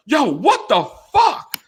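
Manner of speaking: high, slow mock fiance voice; a hammed-up angry fiance; imitating fiance. Fiance phoning him the next morning: high mock outrage.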